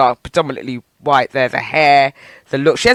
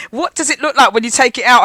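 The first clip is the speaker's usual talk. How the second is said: higher mimicry of a nosy admirer; gushing woman; 7 semitones higher, 4 dB louder than usual. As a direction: imitate her keen, prying question. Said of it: "That's what the woman was doing".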